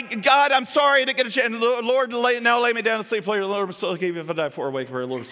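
hasty rote prayer, words tumbling together; gabbled prayer. Acted. Busy person squeezing in a bedtime prayer, gabbling. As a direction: race through it, starting high and running down.

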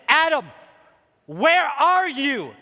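loud, high, drawn-out summons; calling out. Acted. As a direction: act God hollering for someone hiding. Acting God calling out for Adam.

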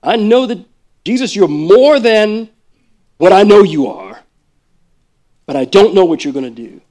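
plead, half sure; wavering faith. Pretend. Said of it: "vacillating between".

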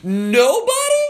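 high, loud, incredulous outburst; retold shocked yell. Preacher retelling his altar call to the village, shocked yell. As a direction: yell it out in stretched disbelief. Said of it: "So I really yelled out".

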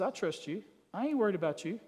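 gentle, unworried murmur; acted reassurance. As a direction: reassure her quietly, as a husband would. Voicing Solomon reassuring his wife.

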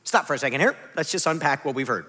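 normal talking voice

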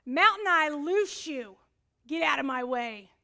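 loud, slow mock command to the mountain; commanding shout. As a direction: bellow it at the mountain like a warrior. Sister commanding mountain, mock prayer.

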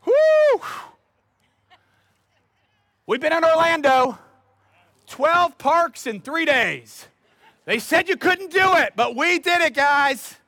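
loud, high-pitched whooping boast; hyped-up vacationer. Retold or imitated. Preacher mimics congregants back from Orlando, hammed-up excitement. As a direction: crow about it like an overexcited tourist. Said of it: "you guys are usually like".

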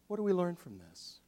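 usual speaking voice

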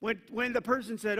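ordinary talk, nothing marked

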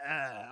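low, loud head-cold groan; congested groan. Acted. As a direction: groan through a stuffed head. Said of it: "you're gunked up".

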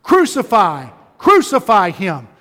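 loud cry of crucify; the crowd shouting to Pilate, loud demand; mob shout. Retold or imitated. Retelling the crowd scene.

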